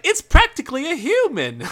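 high, loud, hammed-up English accent; bad English accent. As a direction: blurt it in a dreadful squeaky English accent. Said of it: "I apologize to all English".

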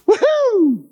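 excited whoop. Retold or imitated. Man cheering at his family's plan, whooping with joy. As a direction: let out a big happy cheer. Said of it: "I was super excited".

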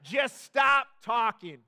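put-on slow, loud, pleading groan of a fed-up teenager; exasperated teen. Teenage daughter begging dad to end the lecture, hammed-up groan. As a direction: drag it out like a bored kid begging. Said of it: "that you'll be like".

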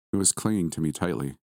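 plain reading voice

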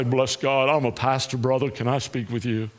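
low, monotone mock-preacher voice; dropped-pitch parody of a formal pastor; pompous pastor. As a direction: put on a stock pastor's solemn greeting. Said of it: "not say".

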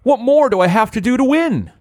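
high, strained whine; whiny despairing voice. Acted. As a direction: wail it like a sore loser. Matt's despairing cry.

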